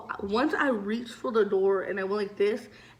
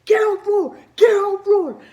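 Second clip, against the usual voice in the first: high, loud police shout; frantic cop yelling orders; 12 semitones higher, 10 dB louder than usual. Retold or imitated. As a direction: bark it like a raiding cop. Cops storming the classroom.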